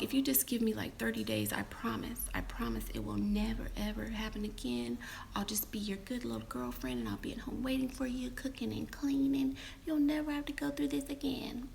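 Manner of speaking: soft, whispered, sugary pleading; fake sweet promise. Woman making fake promises to win him back, whispery. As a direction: coo it like a good girl.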